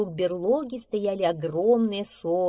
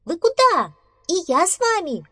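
very high, loud, eager squeak; little mouse; 12 semitones higher, 7 dB louder than usual. Acted. Little mouse wants to join friends, piping up. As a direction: pipe up loudly, pitched way up. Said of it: "маленький мышонок".

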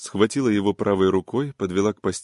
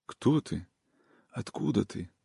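faint, much softer questions; weak and faint, softer; 7 dB quieter than usual. A narrator's reading. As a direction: ask barely above a breath, drained. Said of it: "слабым голосом".

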